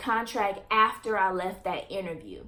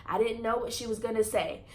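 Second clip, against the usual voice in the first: normal talking voice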